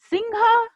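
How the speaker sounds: पाठः called out high with the final breath of the visarga; Sanskrit recitation. Shown as a demonstration. Teacher models Sanskrit word for pupils, called out high. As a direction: model पाठः high and clear for the class to echo. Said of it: "जैसे जैसे उच्चारण किया है".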